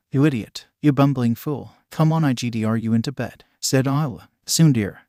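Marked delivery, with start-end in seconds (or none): none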